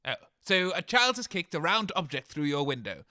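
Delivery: ordinary delivery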